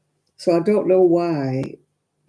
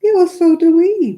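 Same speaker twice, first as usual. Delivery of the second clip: emphatic retort; 13 semitones higher, 8 dB louder than usual. Real, not acted. Retorting to chat.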